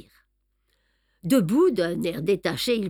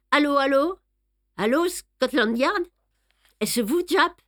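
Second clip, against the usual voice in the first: calling on the phone; 7 semitones higher than usual. A narrator's reading. Poirot calling Scotland Yard.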